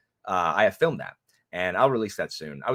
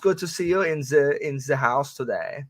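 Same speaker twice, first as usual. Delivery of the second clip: mock German accent; 7 semitones higher than usual. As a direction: greet her like a stiff German relative. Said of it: "They say".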